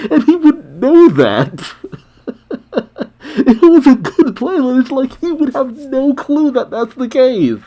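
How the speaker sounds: cracking up, very high-pitched; laughing. Real, not acted. Reader explaining why the punchline works, laughing. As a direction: squeeze the words out through laughter.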